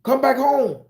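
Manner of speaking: high, loud welcoming call; hammed-up come-home plea; mock inviting call. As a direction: lure them home with a sweet high call. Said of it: "they say".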